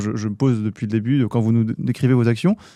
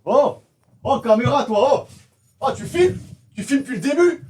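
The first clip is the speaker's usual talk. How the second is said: shocked, high-pitched; 10 semitones higher than usual. Acted. Shocked shouting about the camera.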